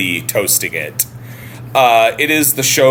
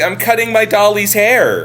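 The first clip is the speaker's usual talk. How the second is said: higher, lightly voiced little girl; a light take on a small child answering sweetly; retelling the girl explaining what she is doing; 9 semitones higher than usual.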